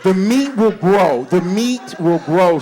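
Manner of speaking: drawn out, selling it; deliberately slow mock-wise explanation; slow, emphatic. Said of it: "repeated for emphasis".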